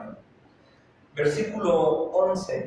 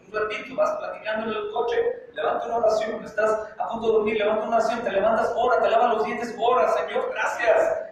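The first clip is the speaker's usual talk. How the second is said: raised, louder preaching voice; preaching at full force; 6 semitones higher, 3 dB louder than usual. Acted. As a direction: urge the congregation on, fervent and insistent. Preacher exhorts to pray always.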